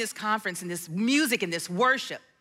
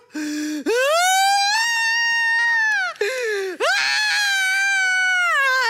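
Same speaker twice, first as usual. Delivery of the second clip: sudden high, loud crying wail; wailing gasp; 5 dB louder than usual. Retold or imitated. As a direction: break into a loud sobbing wail. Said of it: "I wailed".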